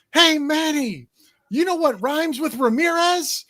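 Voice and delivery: high, drawn-out taunting call; heckler calling out. Retold or imitated. Friend heckling Manny from the stands, high drawn-out call. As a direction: call it slowly out to the outfield. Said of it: "and my friend goes".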